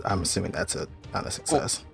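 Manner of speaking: usual speaking voice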